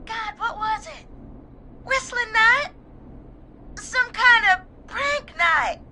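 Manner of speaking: high, crying recollection; tearful. Acted. Caller recalls dying friend's story of revenge, tearful. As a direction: struggle to recall through tears.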